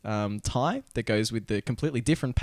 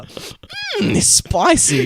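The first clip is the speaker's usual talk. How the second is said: mock seductive; 10 semitones higher, 6 dB louder, much slower than usual.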